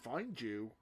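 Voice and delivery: usual speaking voice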